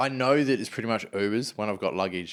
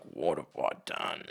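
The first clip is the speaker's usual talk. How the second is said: quiet dread; 8 semitones lower, 7 dB quieter than usual. Retold or imitated. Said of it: "And I was like".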